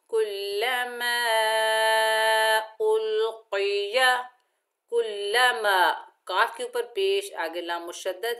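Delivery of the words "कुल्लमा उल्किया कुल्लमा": slow tajweed recitation; Quranic recitation. Shown as a demonstration. Teacher reciting the next phrase slowly. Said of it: "next पढ़िए".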